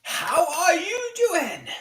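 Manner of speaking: very high, slow sung-out question; high drawn-out greeting. Acted. Sing-song repeat of question.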